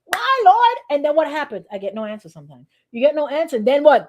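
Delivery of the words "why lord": high loud plea to the Lord; high, loud pleading with God; pleading cry. Retold or imitated. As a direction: cry out, begging why. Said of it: "sometimes I'll be like".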